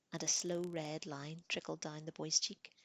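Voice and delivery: plain reading voice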